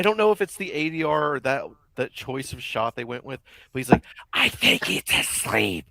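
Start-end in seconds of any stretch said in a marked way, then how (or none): 4.3-5.9 slow, husky mock movie delivery; hammed-up imitation of a trailer line; imitating an actor's line. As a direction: drag it out like an overdone film line. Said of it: "he says it so fucking loud".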